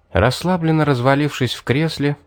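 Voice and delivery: usual speaking voice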